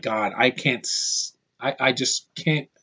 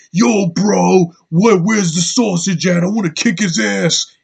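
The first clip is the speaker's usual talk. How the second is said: loud, higher bro-dude swagger; douchebag bro voice; 5 semitones higher, 6 dB louder than usual. Retold or imitated. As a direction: play the cocky gym bro looking for a fight. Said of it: "He sounds just like that".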